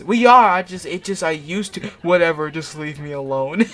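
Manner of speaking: loud, high, hammed-up protest; flustered outburst. A narrator's reading. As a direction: blurt it loudly, breaking into a laugh. Acted quarrel, flustered outburst.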